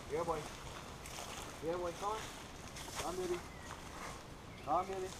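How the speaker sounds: coaxing an animal. Real, not acted. Guide coaxing monkeys to come down.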